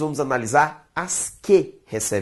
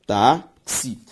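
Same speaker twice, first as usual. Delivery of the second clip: "táxi" split into tá, then xi; syllable by syllable. Shown as a demonstration. Teacher doing syllable separation, "táxi" syllable by syllable. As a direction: sound out "táxi" in two pieces with a pause between. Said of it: "faço a separação silábica".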